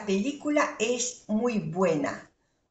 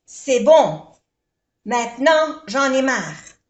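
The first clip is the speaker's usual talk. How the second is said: sharp, much louder fed-up snap on C'est bon; 3 semitones higher, 7 dB louder than usual. Shown as a demonstration. Teacher showing someone who has had enough, loud and fed up. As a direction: bark it out, sick of it all. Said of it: "no es tan favorable".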